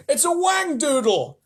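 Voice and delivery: very high, loud, slow; yell. Acted. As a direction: yell the name in alarm. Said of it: "yelled the head of the fire department".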